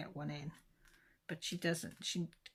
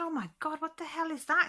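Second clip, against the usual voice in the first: much higher, louder alarmed outburst; shocked exclamation; 10 semitones higher, 6 dB louder than usual. Retold or imitated. Mother shocked by daughter's veins, asks nurse in a high alarmed outburst. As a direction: blurt it out in real alarm.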